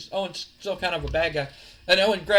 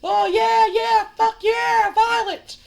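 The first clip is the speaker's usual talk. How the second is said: high, loud, drawn-out crowd cheer; 8 dB louder, much slower than usual. Acted. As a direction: whoop like a bloodthirsty crowd. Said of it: "the crowd's just like".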